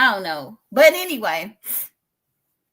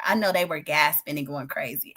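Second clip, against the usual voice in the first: ordinary delivery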